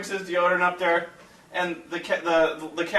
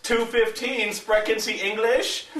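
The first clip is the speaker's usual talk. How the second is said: imitating someone talking slowly to a foreigner; condescending; 5 semitones higher, slower than usual. Cashier repeats the price to foreign customer, slow and condescending. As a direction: spell it out slowly, mockingly, a little higher.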